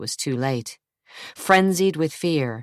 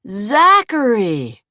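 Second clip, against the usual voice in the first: loud, slow call of relief that rings false; calling out his brother's name, faking relief; forced relief; 7 semitones higher, 7 dB louder, much slower than usual. A narrator's reading. Jason calling to his brother, loud forced relief. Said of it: "Jason forced himself to sound relieved".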